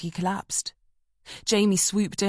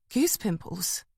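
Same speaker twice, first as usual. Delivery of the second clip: puzzled echo; slower than usual. A narrator's reading. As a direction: repeat "Goose pimples?" caught off guard. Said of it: "Jamie was thrown by the question".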